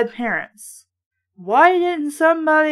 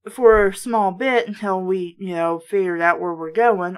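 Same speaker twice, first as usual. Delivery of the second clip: ordinary delivery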